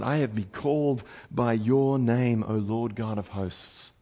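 very slow, low and soft; reverent recitation. Acted. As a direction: let the scripture sink down, word by word. Preacher repeats the prophet's words reverently.

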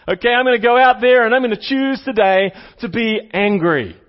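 hammed-up resolve to go be angry; mock eager declaration. Shown as a demonstration. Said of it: "That's what it sounds like when you read it".

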